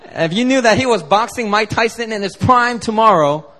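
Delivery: usual speaking voice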